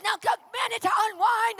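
high, loud rallying order; shouted command. Retold or imitated. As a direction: command the whole room at full voice. Woman commanding crowd, retold.

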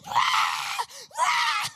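loud high shriek of shock; scream. Retold or imitated. Seeing the verse in the hotel room, a loud high scream. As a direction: let out a startled double scream. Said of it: "I screamed in the hotel room".

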